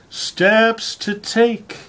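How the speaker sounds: slow, grand announcement of the title; mock-solemn title reading. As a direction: present the title with mock gravity. Reads the essay title.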